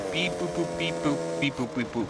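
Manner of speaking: soft, playful robot beeps; mock robot chatter, kept soft; pretend robot language. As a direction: make robot noises, high then dropping. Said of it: "didn't think she liked my, uh, language".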